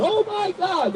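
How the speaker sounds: very high, softened mock wail; mock scream. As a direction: imitate a scream far up in pitch, quietly. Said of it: "Y'all always screaming".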